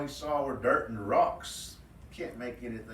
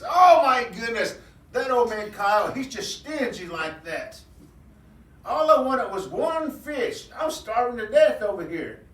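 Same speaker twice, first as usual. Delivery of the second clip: indignant rabbit; 11 semitones higher, 11 dB louder than usual. Acted. Rabbit on the bank complaining about stingy Coyote, high and loud. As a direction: jump around fuming about stingy Coyote.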